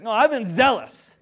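high, loud defensive protest; defensive insistence. Pretend. Elijah insisting to God, defensive. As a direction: insist on it, covering up fear. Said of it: "He's like".

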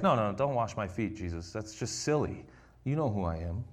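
humble protest. Retold or imitated. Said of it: "he's like".

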